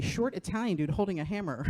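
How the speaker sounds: ordinary talk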